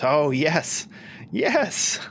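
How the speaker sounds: high, bright recognition; light up with memory; Lich voice remembering. Acted.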